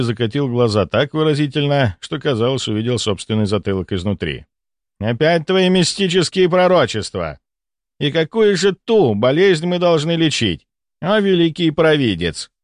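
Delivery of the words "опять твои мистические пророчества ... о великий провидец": high, mocking mock-reverence; sarcastic. A narrator's reading. Volkonsky derides his colleague's diagnosis, sarcastic. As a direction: pitch it up and lay the sarcasm on. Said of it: "Сарказм в его голосе".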